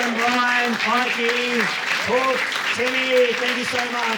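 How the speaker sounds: high, sustained shout-out over applause; calling out thanks. Real, not acted. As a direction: project names to a cheering crowd.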